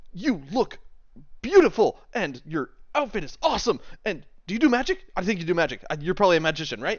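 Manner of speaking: high, loud gushing compliments; overjoyed fan. Acted. Fan looking over at a magician, overjoyed. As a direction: babble admiringly at your new idol. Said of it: "like, the happiest person on the planet".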